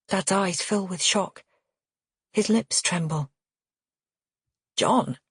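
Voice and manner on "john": voice cracking. Acted. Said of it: "His voice cracks".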